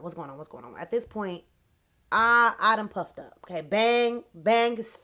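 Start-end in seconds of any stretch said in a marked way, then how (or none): 0.0-0.8 low, soft muttering to yourself; puzzled muttering. Retold or imitated. Trying to figure out her hair, puzzled muttering. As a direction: mutter it under your breath, low. Retells puzzling to herself all day.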